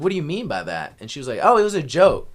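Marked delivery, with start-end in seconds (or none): 0.0-1.0 higher and louder, demanding; challenging question. Retold or imitated. Demanding an explanation from the owner's wife, pointed. 1.4-2.3 high, loud, airy brush-off; dismissive wife. Retold or imitated. The owner's wife waving off her comment, high and breezy.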